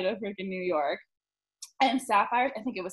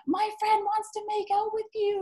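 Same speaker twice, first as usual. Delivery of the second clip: much higher, brash announcement; bold friend; 11 semitones higher than usual. Retold or imitated. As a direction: call it out shamelessly. Friend yells at the dancer.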